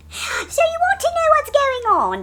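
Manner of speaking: high, loud, drawn-out comic screech; Monty Python. Acted. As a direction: open the sketch in a hammed-up high voice. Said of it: "I'm mocking Monty Python".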